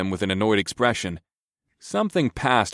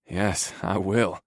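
rumbling; much slower than usual. A narrator's reading. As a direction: rumble it, heated. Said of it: "I rumbled".

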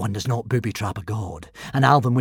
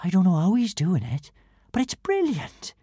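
put-on higher, amazed young girl; awed girl; 7 semitones higher than usual. Girl marvelling as Hiccup flies above the crowd. Kamikaze amazed by flying boy.

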